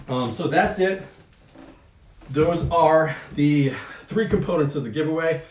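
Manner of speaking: talking while short of breath. Real, not acted. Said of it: "I'm running out of breath".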